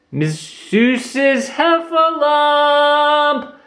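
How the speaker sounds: long, high, sung-out call. Acted. Roo calls for Lumpy's mother, long high call. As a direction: call across the forest, drawn out. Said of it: "Roo called".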